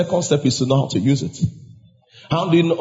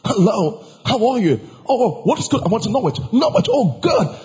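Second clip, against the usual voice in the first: imitated proper British accent, high-pitched; 6 semitones higher than usual. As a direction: put on a proper British small-talk voice. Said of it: "he could hear the proper British accent".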